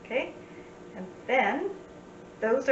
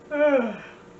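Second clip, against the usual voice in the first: loud, higher mock yawn sliding down; demonstrated yawn; 4 semitones higher, 7 dB louder than usual. Shown as a demonstration. Sign teacher acting out tired for students, mock yawn. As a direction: fake a big yawn. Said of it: "and you just yawn".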